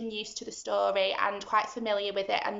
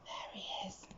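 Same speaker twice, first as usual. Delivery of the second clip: much softer hushed whisper; 4 semitones lower, 19 dB quieter than usual. Pretend. Teacher whispers to the children, hushed. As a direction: whisper so the giant won't hear.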